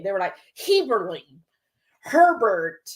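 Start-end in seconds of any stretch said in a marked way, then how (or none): 0.6-1.4 "Heberling" as HEE-ber-ling, an extra ending; mispronounced surname. Retold or imitated. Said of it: "they made everything possible but the actual last name". 2.0-2.9 "Herbert" as HER-bert, with an added R; mispronounced surname. Retold or imitated. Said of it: "they made everything possible but the actual last name".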